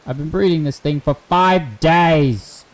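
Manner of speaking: loud, high, drawn-out exasperation; loud exclamation. Real, not acted. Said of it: "Sorry for being loud".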